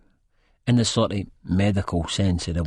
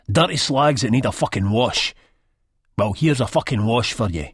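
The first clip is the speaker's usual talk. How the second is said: raised, higher-pitched aggressive taunt; contemptuous threat; 3 semitones higher, 3 dB louder than usual. A narrator's reading. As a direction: throw it out as abuse. Abuser's threat in acted scene.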